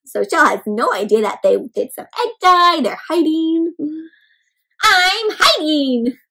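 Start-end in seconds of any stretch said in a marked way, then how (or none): 4.8-6.2 cheerful Greg. Acted. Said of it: "says Greg".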